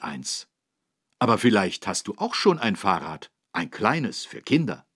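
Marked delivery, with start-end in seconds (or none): none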